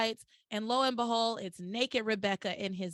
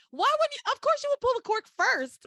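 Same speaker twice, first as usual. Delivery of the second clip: incredulous; 13 semitones higher, 6 dB louder than usual. Real, not acted. Exasperated at the plot.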